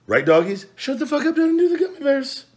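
very high, loud squeaky voice; silly voice to the dogs. Pretend. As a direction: talk to the dogs in a squeaky cartoon voice.

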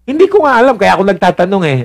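husband's voice jumping high in exasperation; exasperated husband. Acted. Husband protesting to his wife, high exasperated pitch. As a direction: protest that you really don't know.

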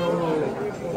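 ordinary talk

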